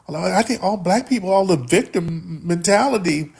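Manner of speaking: mocking quote. Host parroting an opponent's view to listeners, mocking.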